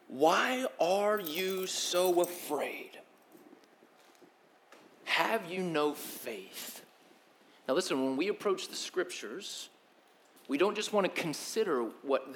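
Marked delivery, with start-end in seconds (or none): 0.1-6.8 very slow, soft, tender asking; gentle question. Retold or imitated. Jesus to the frightened disciples, slow and gentle. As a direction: ask it gently, full of patience. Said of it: "He says".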